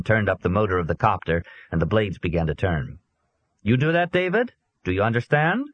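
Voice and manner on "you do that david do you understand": Swedish policeman giving orders, raised; lightly Swedish, firm and commanding; Swedish accent. A narrator's reading. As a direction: Swedish officer instructing the boy. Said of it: "the policeman asked with an accent".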